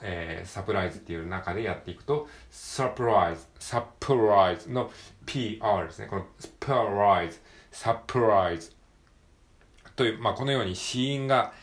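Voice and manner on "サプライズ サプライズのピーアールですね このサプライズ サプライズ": サプライズ with P and R joined in one go; pronunciation drill; PR blended. Shown as a demonstration. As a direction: read サプライズ in one breath, louder. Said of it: "一気に読むと".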